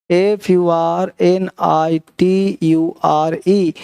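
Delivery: each letter spaced out slowly for dictation; slow board-dictation spelling. Shown as a demonstration. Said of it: "spelling the word for the board".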